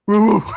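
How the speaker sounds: high, loud cartoon dog bark; Scooby-Doo. Friend showing his first diaphragm exercise, cartoon dog woofing. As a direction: attempt a dog bark that comes out as a silly high howl. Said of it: "like a Scooby-Doo fucking dog bark".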